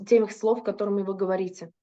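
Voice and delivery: usual speaking voice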